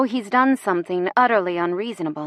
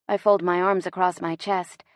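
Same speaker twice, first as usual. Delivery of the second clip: ordinary delivery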